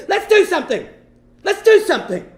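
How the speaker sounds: put-on high, loud cry of a drunk sailor; hammed-up manic pleading; wild drunken urging. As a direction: egg them on at full pitch, wild-eyed. Wide-eyed drunk navy guy demands action.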